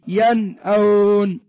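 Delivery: loud, very slow "ينأون", clear ن then hamza; إظهار النون. Shown as a demonstration. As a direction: punch out "ينأون" with the ن fully sounded. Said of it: "ظهرت النون ولا ما ظهرت؟". (نطق "ينأون" بصوت عالٍ وبطء مع إظهار النون)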